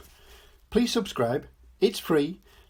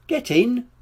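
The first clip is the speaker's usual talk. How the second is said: brisk order; 11 semitones higher than usual. A narrator's reading. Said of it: "she said".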